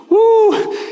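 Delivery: high, loud, drawn-out woo; excited whoop. Retold or imitated. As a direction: let out a big thrilled whoop. Whoops over the reply.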